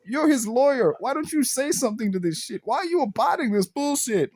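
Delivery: high-pitched, incredulous protest; indignant. Retold or imitated. Viewer scolding the lawyer for staying silent, indignant. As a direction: challenge them in high exasperation. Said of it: "There were moments where I was like".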